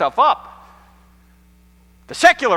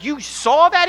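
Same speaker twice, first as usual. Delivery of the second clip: stunned; 11 semitones higher, 6 dB louder, slower than usual. Retold or imitated. Stunned question to pastor.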